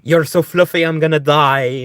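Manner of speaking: loud, excited gushing; overwhelmed by cuteness. Real, not acted. Gushing at fluffy cat.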